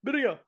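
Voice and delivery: "Birria" with a trilled R, very high pitch; rolled R. Shown as a demonstration. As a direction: squeak out "Birria" rolling the R. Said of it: "supposed to roll your R's".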